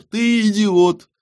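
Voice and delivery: very high, slow and loud jibe; mocking verdict. A narrator's reading. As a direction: stretch the insult out high and mockingly. Mercenary mocks the bookseller as idiot.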